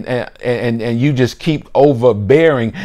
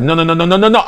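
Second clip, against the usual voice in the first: rapid string of pleading refusals, higher; frantic inner voice; 5 semitones higher, much faster than usual. Acted. Conscience trying to stop an outburst, rapid higher warning. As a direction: rattle out a rising warning. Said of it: "like that still small voice".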